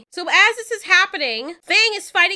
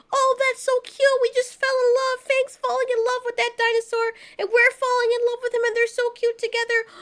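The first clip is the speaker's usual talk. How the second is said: much higher, squealing over how cute they are; gushing; 8 semitones higher, faster than usual. Retold or imitated. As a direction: swoon like a fan seeing the couple. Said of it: "It had me feeling like".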